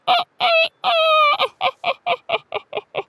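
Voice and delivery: high crowing rooster laugh; cock-a-doodle laugh. A narrator's reading. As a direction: cackle like a rooster at his own joke. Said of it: "cock-a-doodle-doodling laugh".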